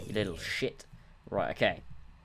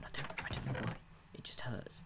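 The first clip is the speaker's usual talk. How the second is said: whispering; 3 semitones higher, 10 dB quieter than usual. Real, not acted. Complaining about arm.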